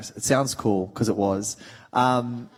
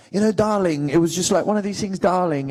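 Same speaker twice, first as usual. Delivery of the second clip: Tom Hardy; 6 semitones higher than usual. Retold or imitated. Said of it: "Tom Hardy interviews".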